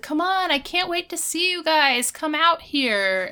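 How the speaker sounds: high, excited bride calling her friends out; gushing Topanga. Retold or imitated. Bride calls bridesmaids out of the fitting room, high and gushing. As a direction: squeal it like a thrilled bride. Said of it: "then she's like".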